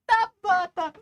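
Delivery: high, loud laughing squeal. Real, not acted.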